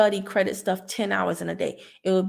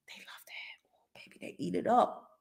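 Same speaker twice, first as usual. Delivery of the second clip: breathy conspiratorial whisper; 3 semitones lower, 9 dB quieter than usual. Real, not acted. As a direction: share a secret in a whisper.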